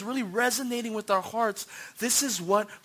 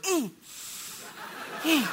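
high, closed-mouth squeal of excitement; stifled cheer; 8 semitones higher than usual. Retold or imitated. Husband forbidden to yell, stifled cheering. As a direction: hold the shout in behind closed lips. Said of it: "I was like".

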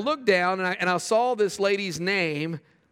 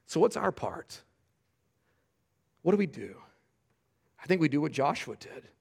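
ordinary talk

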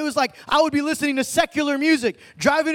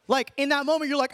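ordinary delivery

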